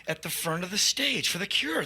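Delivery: ordinary delivery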